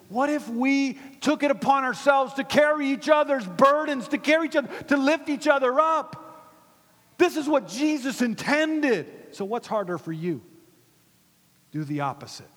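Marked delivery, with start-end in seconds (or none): 0.1-9.1 loud, high rallying sermon; rally the congregation at full force; big, raised preacher's voice; impassioned preaching. Real, not acted.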